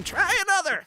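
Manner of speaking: high, hammed-up old prospector. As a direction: squeak it like the cartoon miner. Imitating the game's prospector.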